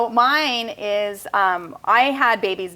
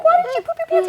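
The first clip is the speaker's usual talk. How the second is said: high, louder exasperated question; shocked mom; 6 dB louder, faster than usual. Retold or imitated. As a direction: mom asking her son in disbelief, high and loud. Mom reacting to son.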